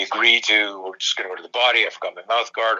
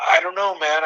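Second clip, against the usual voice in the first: put-on baffled teen; 8 semitones higher than usual. Student's baffled reply retold.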